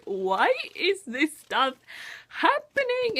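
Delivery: mock despair at the drama; exasperated wail. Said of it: "I was just like".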